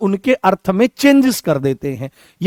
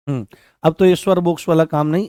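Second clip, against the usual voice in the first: ordinary talk, nothing marked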